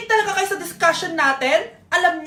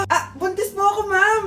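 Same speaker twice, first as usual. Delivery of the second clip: ordinary talk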